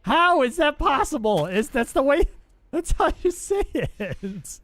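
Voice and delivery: high, slow, incredulous whine; mock disbelief. Streamer protesting chat's teasing, high and incredulous. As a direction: act baffled that anyone says it differently.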